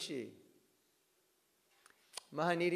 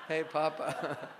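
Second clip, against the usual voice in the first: laughing through the joke; slower than usual. Real, not acted.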